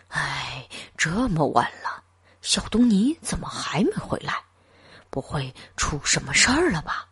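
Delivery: a father fretting under his breath; anxious muttering. A narrator's reading. Father worrying to himself, soft anxious muttering. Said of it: "焦躁不安地自言自语".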